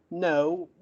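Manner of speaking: usual speaking voice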